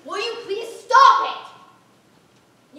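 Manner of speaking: loud, high, drawn-out demand; exasperated shout. Acted. Teacher stopping a student reading her speech, exasperated shout. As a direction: yell to shut the student up.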